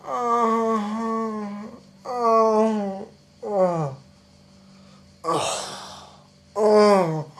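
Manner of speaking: long drawn-out sleeper's groans; imitated sleep noises. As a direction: mimic a noisy sleeper, groan after groan. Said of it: "bloody noises he makes in the night".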